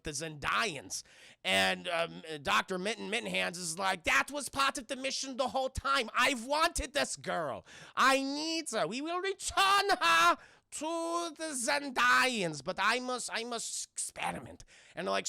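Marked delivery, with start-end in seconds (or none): 4.0-14.6 high, slow, hammed-up German accent with a shouted peak; a German villain explaining his plan; exaggerated German-accented scientist. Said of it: "very stereotypical German accent".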